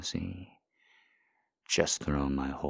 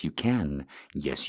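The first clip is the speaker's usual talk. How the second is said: ordinary talk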